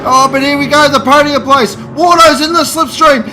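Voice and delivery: rising frantic finish call; excited commentary yell. Real, not acted. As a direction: shout the photo finish. Race commentary, close finish climax.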